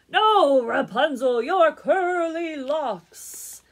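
high, very loud, exasperated call; loud comic correction, stretching the last words; shouted correction. A narrator's reading. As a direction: holler it up slowly so she hears. Prince shouts his correction up.

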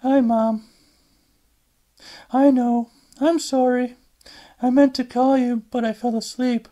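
tired daughter excusing herself to her mother; Alice on the phone. Acted.

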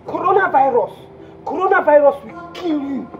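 high, loud outraged tirade; explode, then pile curse upon curse; furious cursing. Acted. Jilted caller cursing Joseph in London, loud high fury.

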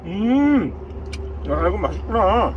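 talking while chewing; eating noodles mid-sentence; mouth full of spaghetti. Real, not acted. Seen 0: Just slurped noodles at -3; head down, mouth working while speaking.

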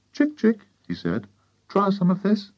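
high, light coaxing call; boy coaxing a hen. A narrator's reading. George offering the spoon to a hen, high coaxing.

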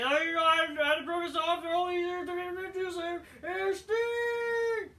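high, excited announcer voice; Tony Schiavone impression. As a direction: ham it up like a gushing wrestling announcer. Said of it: "And Tony Schiavone".

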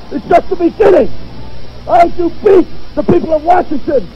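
screaming, furious promo; wrestling promo. Acted. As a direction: roar every threat at the rival.

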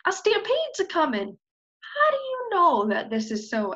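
high, lively character voices; storybook voices. Acted. Animals trade news of the stampede. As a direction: play it breathless.

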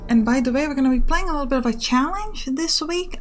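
ordinary talk

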